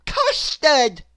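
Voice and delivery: high, loud delighted cry; Mister Nonsense voice. A narrator's reading. Mister Nonsense mistaking snow for custard. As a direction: exclaim with glee.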